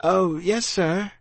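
high-pitched obedient youngster; meek child. A narrator's reading. Child to snapping officer, high and obedient. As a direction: answer up high, eager to placate.

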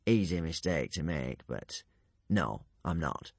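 soft, low, feeble denial; faint. A narrator's reading. Half-drowned man denying he is the corpse, faint voice. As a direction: trail out weakly, very softly. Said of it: "it said faintly".

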